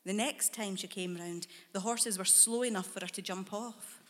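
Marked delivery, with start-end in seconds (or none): none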